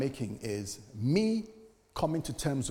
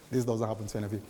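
usual speaking voice

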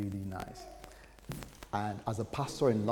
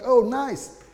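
much higher, louder, cheerful; eager kids; 7 semitones higher, 8 dB louder, slower than usual. Retold or imitated. Kids hearing about the move, high and delighted. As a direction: bounce with glee like children. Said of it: "they were like".

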